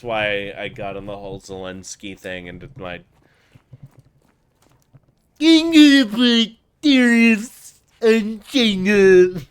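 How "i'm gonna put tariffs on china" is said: higher, louder, slow Trump voice; Trump impression. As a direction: boast like Trump at a rally. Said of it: "on my Donald Trump shit".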